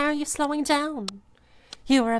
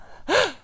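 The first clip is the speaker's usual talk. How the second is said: sharp gasp; 8 semitones higher, 3 dB louder than usual. Acted. Gasp in roleplay.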